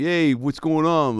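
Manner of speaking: slow, laid-back greaser greeting; lightly voiced Fonz-meets-Zuko cop; Fonzie-style cool cop. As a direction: lean into a cool fifties greaser. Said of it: "Arthur Fonzarelli meets a little Danny Zuko".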